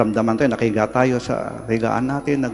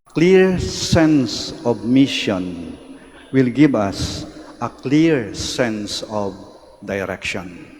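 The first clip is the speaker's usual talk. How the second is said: slow emphatic; much slower than usual. Real, not acted. Key sermon line said slowly.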